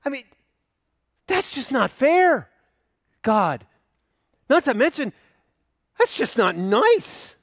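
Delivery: high, loud incredulous objection; indignant protest. Retold or imitated. Empathetic listener protests to God, high and indignant. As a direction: protest like it's outrageously unfair. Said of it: "you look at this and go".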